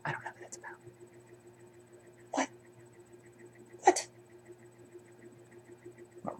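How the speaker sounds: breathy unvoiced whisper. Real, not acted. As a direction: whisper it under your breath. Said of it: "whispered aside".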